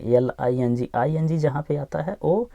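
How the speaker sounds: normal talking voice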